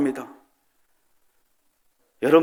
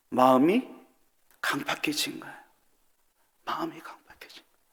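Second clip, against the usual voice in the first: hushed whisper; 10 dB quieter than usual. Acted. Preacher whispering to the congregation about hardened hearts: soft whisper. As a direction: drop to a breathy whisper.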